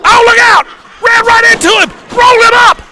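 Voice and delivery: high, shouting finish call; wrestling commentator. Real, not acted. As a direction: roar the three count. Live wrestling play-by-play.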